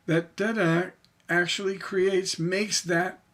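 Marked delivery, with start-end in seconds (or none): none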